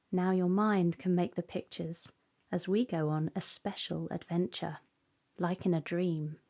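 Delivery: calm, unhurried meditation voice; guided meditation. Acted. Guide helping children settle and close their eyes, calm meditation. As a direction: ease the listener gently into stillness, pausing between phrases.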